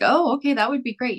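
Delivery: light up at the offer; pleased surprise. Retold or imitated. Agreeing to massage the tenant's staff, high and delighted. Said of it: "And I was like".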